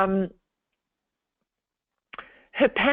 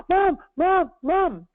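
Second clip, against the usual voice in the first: panicked calling; 10 semitones higher, slower than usual. Retold or imitated. Daughter calling her mum mid-panic attack, frantic and high.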